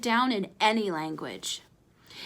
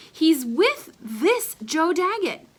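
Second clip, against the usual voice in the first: put-on higher, drawn-out boy's voice; Ace, slow and pointed; 6 semitones higher, 4 dB louder, slower than usual. Ace insisting to his friend, slow and higher. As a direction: stretch it out like a kid making his point.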